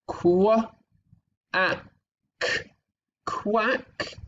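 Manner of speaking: slow sound-by-sound blending. Shown as a demonstration. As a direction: sound out each word slowly for children. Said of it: "so this sentence here, we have".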